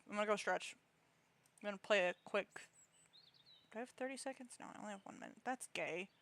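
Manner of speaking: ordinary talk, nothing marked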